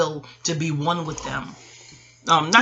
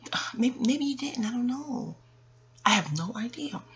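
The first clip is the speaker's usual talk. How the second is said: soft unvoiced whisper; whispered aside about her significant other; 5 semitones higher, 8 dB quieter than usual. Real, not acted. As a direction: drop to a secret whisper. Unsure whisper about partner.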